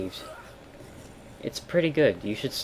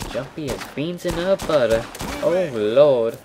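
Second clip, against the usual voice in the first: a hammed-up, terrible Southern drawl, pitched higher; bad Southern accent; 5 semitones higher, 6 dB louder than usual. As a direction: play a corny Texan with an awful Southern accent. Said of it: "That was a terrible Southern accent".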